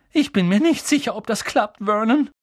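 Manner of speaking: high, worried wife voice; doubtful aunt. A narrator's reading. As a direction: voice her doubt nervously.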